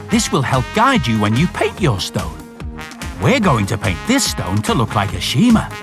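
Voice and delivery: ordinary delivery, nothing marked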